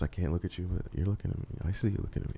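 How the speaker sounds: hushed, soft playful aside; mock inner voice. Narrator voicing flirty glances to podcast hosts, hushed. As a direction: murmur it like a secret glance. Acting out shy glances at bar.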